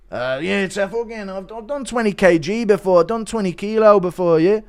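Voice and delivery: hammed-up Irish fighter answering; Conor McGregor. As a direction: boast in a put-on Irish lilt. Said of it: "Connor answering the question".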